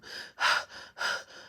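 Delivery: voiceless, heavy panting breaths; imitated panting. Waking from the dream with a jolt, imitated panting. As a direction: act out waking up gasping for air. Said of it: "I'm panting".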